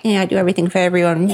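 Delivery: fast, bitter little rat voice, rushed out; mock the worn-out martyr as a cornered rat; hissing rat. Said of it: "that ugly rat in the corner who's hissing like".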